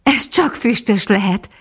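a touch of joyful certainty; happy exclamation. A narrator's reading. Mistress recognising her returning dog, happy exclamation. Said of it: "kiáltott fel asszonyka boldogan".

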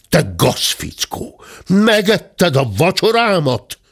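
acted angry yell, climbing high; outraged accusing shout; furious howl. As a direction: start slow on the insult, then burst out high. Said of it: "üvöltötte".

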